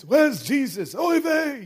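put-on panicked Yiddish-accented parent.

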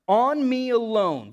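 emphatic plea. Acted. Reading Abigail's plea aloud.

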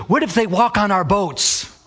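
high, slow mock alarm; imitated objecting neighbor. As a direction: draw out the absurd worry as the neighbor. Retold woman's absurd objection.

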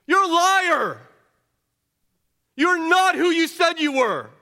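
mock the bound man as the turned crowd would; jeering crowd. Preacher voicing the fickle crowd jeering at Jesus, loud and scornful. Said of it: "you wonder if they must have reached the conclusion".

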